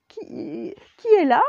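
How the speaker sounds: qui est là, aigu et fort, affolé; professeur apeuré. Acted. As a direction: demande qui est là en tremblant. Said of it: "se mit à claquer des dents". (le professeur terrifié demande qui est là)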